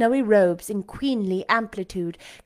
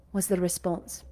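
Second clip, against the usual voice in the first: ordinary delivery, nothing marked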